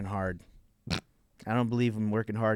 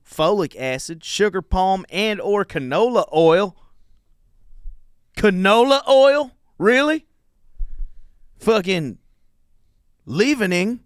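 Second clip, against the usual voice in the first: loud, high-pitched Southern rant; hammed-up Southern indignation; Southern accent; 10 semitones higher, 8 dB louder than usual. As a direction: rail like an outraged Southerner, putting it on. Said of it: "I'll read 'em in a Southern accent".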